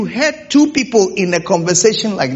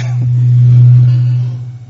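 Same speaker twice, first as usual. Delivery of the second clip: breathy burst of laughter; 6 dB louder than usual. Real, not acted. Preacher laughs at own question.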